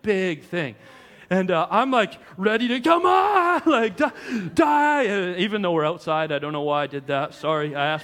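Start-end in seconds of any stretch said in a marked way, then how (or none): 2.8-3.6 mock battle cry. Killing a spider, retold. 4.0-5.1 mock yell. Stomping the spider.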